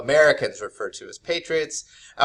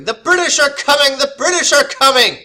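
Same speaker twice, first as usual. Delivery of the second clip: loud, much higher alarm call; shouting warning; 13 semitones higher, 11 dB louder than usual. Retold or imitated. Paul Revere riding through town to warn people, loud high shout. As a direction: yell it like a rider raising the alarm.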